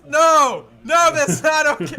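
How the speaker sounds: high, loud shout of alarm; shouted protest. Retold or imitated. Dave shouting at the cheering kids, high and loud. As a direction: yell it to stop a cheering crowd. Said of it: "And he goes".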